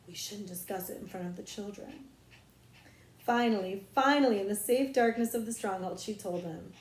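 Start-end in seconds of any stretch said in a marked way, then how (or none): 0.1-2.0 mock-prim hush. A narrator's reading. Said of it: "as if to say".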